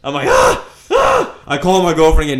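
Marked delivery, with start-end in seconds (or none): 0.3-1.4 short high yelps of alarm; panicked yelp. Retold or imitated. Alone in the dark elevator: high, scared yelps. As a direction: let out two startled high cries.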